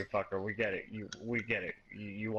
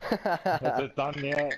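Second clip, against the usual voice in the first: slow whiny nagging; 2 semitones higher, 6 dB louder, slower than usual. Acted.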